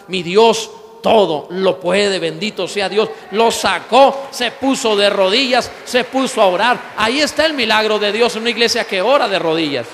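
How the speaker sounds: loud, fervent preaching to the congregation; loud sermon shout; full-force preaching. Acted. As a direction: proclaim it loud like a revival preacher. Preaching on a praying church.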